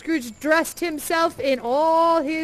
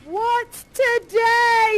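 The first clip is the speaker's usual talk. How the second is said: higher, slow call to the street; yelling down; 9 semitones higher, 6 dB louder, much slower than usual. Acted. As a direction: shout down to the boy below. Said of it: "he yells down".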